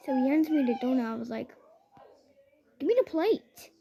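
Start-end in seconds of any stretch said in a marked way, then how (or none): 2.8-3.7 higher, demanding queen; raised bossy request. Retold or imitated. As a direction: ask for the plate like royalty.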